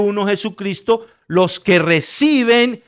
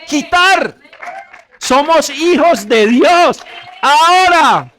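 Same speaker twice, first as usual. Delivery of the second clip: preaching shout; 8 semitones higher, 4 dB louder, slower than usual. Real, not acted. Preacher rising to climax.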